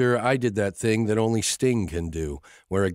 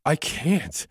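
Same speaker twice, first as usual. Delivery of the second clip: higher, breathless from laughing; gasping laugh; 7 semitones higher than usual. A narrator's reading. Emma out of breath from laughing. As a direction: squeeze it out out of breath. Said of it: "she gasps".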